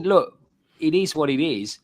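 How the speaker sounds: ordinary delivery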